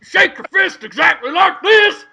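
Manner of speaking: high, loud, very slow mock rant; hammed-up DJ voice. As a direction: ham up a crazy DJ. Mocking DJ voice in story.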